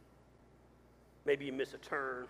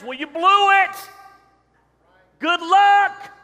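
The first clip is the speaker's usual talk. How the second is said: much higher, louder, slow sneering jab; mocking taunt; 18 dB louder, much slower than usual. Pastor voices a scornful GPS to his congregation, loud mock sneer. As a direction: sneer it like a gloating nag.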